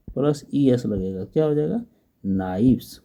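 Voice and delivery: ordinary talk, nothing marked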